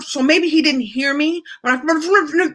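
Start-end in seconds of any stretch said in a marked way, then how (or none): 1.8-2.5 higher, garbled imitation of masked speech; muffled through mask. Customer ordering fish through a mask, comically unintelligible. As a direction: mimic words lost behind a face mask. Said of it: "I had the little mask on".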